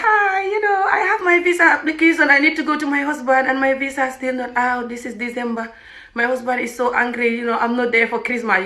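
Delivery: put-on distressed caller; mock tearful plea. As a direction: plead as if about to cry. Acting her plea to the embassy.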